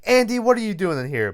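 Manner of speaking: high, quick mock-exasperated demand; mock teacher scolding. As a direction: catch the kid out, mildly hammed-up. Teacher bursts in on Andy.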